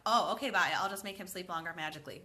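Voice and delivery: ordinary talk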